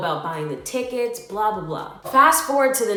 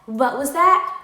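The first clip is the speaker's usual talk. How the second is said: higher, louder challenge, rising at the end; imitated confrontation; 5 semitones higher, 5 dB louder than usual. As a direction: mimic a friend demanding an explanation. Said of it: "she was like".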